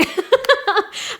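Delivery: high burst of laughter; incredulous laugh. Real, not acted. Vlogger laughing at her coming birthday, incredulous laugh.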